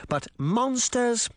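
"but monsters?" high and slow, scornful; scoffing. A narrator's reading. Mayor scoffing at the old woman, slow and high. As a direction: stretch "monsters?" in disbelief. Charming mocking Relda's theory.

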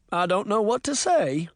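a touch of tongue-tied surprise; fumbling for words. A narrator's reading. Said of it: "fumbling for words".

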